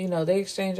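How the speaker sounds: normal talking voice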